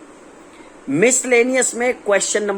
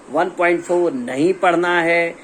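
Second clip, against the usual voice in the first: ordinary talk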